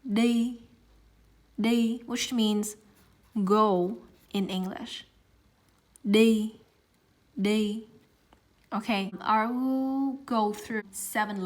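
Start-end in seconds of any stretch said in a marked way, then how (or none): 0.0-7.8 "đi" with a plain d, not English th; Vietnamese đi. Shown as a demonstration. Teacher modelling the word for go. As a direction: say "đi" crisp and clear. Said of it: "the example I have here is đi".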